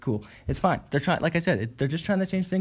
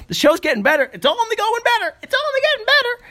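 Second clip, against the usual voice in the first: higher and higher, squeaking; squeaky upbeat; 4 dB louder than usual. Acted. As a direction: push up into a squeak. Forcing himself upbeat.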